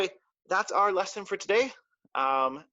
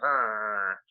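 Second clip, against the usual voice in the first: low grumpy growl; mock angry growl; 8 semitones lower than usual. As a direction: grumble like a sulking kid stomping around. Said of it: "maybe I feel angry".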